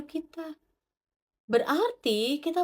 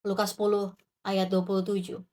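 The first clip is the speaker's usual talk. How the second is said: normal talking voice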